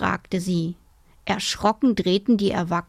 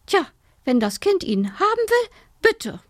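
high-pitched aunt giving in; resigned aunt; 11 semitones higher than usual. A narrator's reading. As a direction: concede it in a high auntly voice. Aunt gives in with a sigh.

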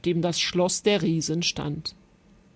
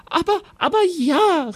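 high-pitched, stammering eager assent; eager queen calling out; 9 semitones higher, 4 dB louder than usual. A narrator's reading. Queen to the knight who may save her son, eagerly calling out. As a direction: agree in a high, urgent rush of feeling. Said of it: "rief die Königin".

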